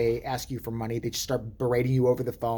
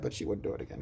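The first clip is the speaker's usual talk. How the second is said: whispered aside; 4 semitones lower, 8 dB quieter, faster than usual. Real, not acted. Aside about Kathy Griffin.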